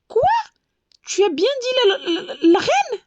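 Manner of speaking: high, stammering astonishment; astonished. A narrator's reading. The butterfly learning he has met the queen, stammering in shock. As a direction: trip over the words in disbelief. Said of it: "s'écria le papillon".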